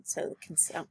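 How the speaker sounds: whispered, as if hiding something; whispered secret; hushed. Shown as a demonstration. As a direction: keep it secret, barely voiced. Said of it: "being hidden".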